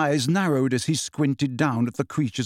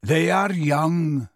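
very slow, mournful drawl; slow, doleful; much slower than usual. A narrator's reading. Centaur behind Harry, slow and doleful. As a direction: draw the words out dolefully. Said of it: "said a slow, doleful voice".

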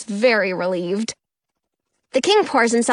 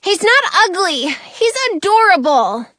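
insisting; 8 semitones higher, 5 dB louder than usual. A narrator's reading. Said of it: "I insist".

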